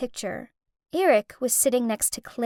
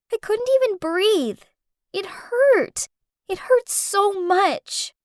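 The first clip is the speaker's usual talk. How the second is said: higher, voice near breaking, a touch of tears; tearful and hurt; 7 semitones higher, slower than usual. Acted. A girl betrayed by her boyfriend, mildly tearful. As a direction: let the hurt crack through, lightly. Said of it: "I burst into tears with anger".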